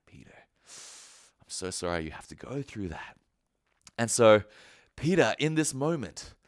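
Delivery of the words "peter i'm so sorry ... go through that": hushed, low and gentle; tender sorrow. Acted. Jesus looking at Peter after his denial, hushed compassion. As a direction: softly grieve for a friend. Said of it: "I just imagine it looking at Peter like".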